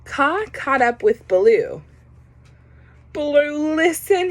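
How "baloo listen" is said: nervously. A narrator's reading. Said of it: "he said nervously".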